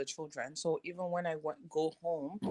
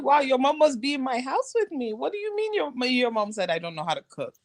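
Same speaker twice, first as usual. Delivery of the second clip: higher, mocking retort; a hint of playful indignation; sarcastic; 6 semitones higher, 3 dB louder than usual. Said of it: "I was like".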